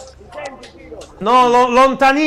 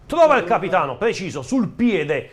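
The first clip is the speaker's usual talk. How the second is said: play-by-play commentary; 4 semitones lower than usual. Acted. Live play-by-play of the match.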